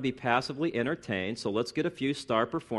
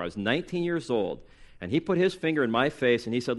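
ordinary talk, nothing marked

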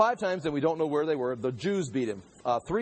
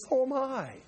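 mocking, pitched much higher; mock boast; 10 semitones higher than usual. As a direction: toss it off like a smug one-up. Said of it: "well, Paul says".

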